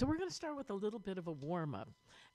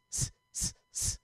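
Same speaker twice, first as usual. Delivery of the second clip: quick sharp s hisses; breath-control hissing demo; short hisses. Shown as a demonstration. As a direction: hiss out short bursts of air on s. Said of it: "alternate that with s".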